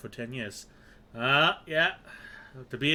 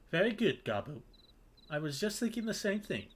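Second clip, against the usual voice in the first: lightly voiced game character, raised pitch; higher-pitched character voice; approving party leader; 10 semitones higher than usual. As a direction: play a leader approving a follower.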